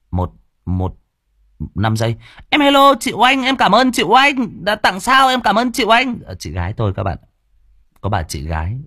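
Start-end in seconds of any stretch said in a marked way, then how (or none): 2.5-6.2 very high, sweet, cutesy thank-you. Real, not acted. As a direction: squeak out thanks to the donor sister. Thanking a donor in a cute voice. (giọng nhõng nhẽo cảm ơn chị Oanh)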